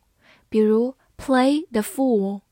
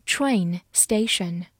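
slow model reading; 3 semitones lower, much slower than usual. Shown as a demonstration. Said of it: "teacher repeats slowly".

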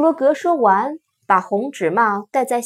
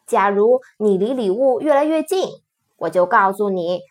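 ordinary delivery

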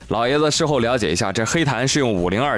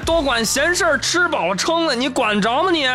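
high, mocking cheater voice; cocky gamer; 10 semitones higher than usual. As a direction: sneer at a busybody, pitched way up. Cheater scolds Peng mockingly.

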